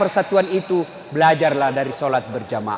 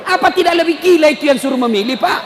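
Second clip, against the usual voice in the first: loud, high, incredulous preacher; high-pitched, forceful sermon outburst; impassioned preaching; 14 semitones higher, 7 dB louder than usual. Real, not acted. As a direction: cry it out to the congregation.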